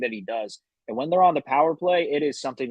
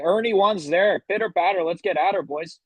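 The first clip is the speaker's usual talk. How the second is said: put-on Canadian accent; 6 semitones higher than usual. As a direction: hype the boys up, Canadian style. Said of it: "I'm getting tired of this accent".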